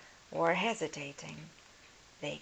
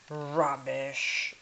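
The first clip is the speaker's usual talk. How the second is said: loud scornful scoff; dismissive; 2 semitones lower, 6 dB louder than usual. Acted. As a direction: brush her off. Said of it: "the lord said".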